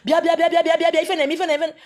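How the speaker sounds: loud high calling to the crowd. Retold or imitated. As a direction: summon everyone over urgently. Calling people to come see.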